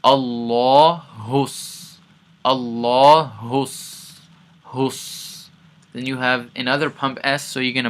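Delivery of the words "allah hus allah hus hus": Allah hus drawn out very slowly, louder; stretched chanted Allah hus; slow recitation. Shown as a demonstration. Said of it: "So hus".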